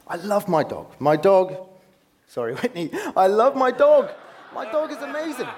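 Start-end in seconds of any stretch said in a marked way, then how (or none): 3.1-5.5 much higher and louder, gushing; delighted dog-lover; excited gush. Real, not acted. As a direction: burst out with love for the dog. Gushing about his dog.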